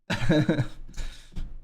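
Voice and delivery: breathless triumphant laugh. Real, not acted. After winning the pot.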